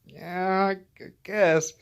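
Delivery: high, loud, halting reluctant yes; uncomfortable. Retold or imitated. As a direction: stammer out an uneasy, drawn-out agreement. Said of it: "I got real uncomfortable".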